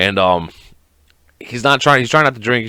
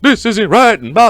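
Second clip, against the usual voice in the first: high, loud mock objection; raised, strained voice of righteous complaint; mock protester; 11 semitones higher, 5 dB louder than usual. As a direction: mimic someone standing up in protest. Mimics people standing up to protest.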